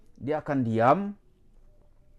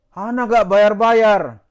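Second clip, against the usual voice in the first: loud, slow, higher mock quote; mock loud declaration; 6 semitones higher, 9 dB louder, much slower than usual. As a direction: drag it out loudly to mock him. Mocking him refusing to pay. (ejekan keras dan lambat)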